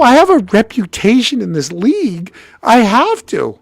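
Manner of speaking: high-pitched self-justification; pleading protest. Retold or imitated. Player defending his reputation to his girlfriend, pleading.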